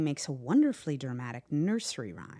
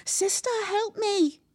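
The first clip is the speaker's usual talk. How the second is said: high, loud wail for help; babyish cry; 7 dB louder than usual. A narrator's reading. Trapped brother begging his sister, crying out. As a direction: wail it like a scared baby. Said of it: "beginning to cry just like a baby".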